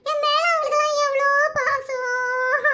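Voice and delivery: slow sob, drawn out at the end; comic wailing. Acted. Hostage moved by friends' affection, wailing. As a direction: break into a long hammed-up wail. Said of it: "நீ ஒப்பாரி வச்சிட்டு இருப்ப".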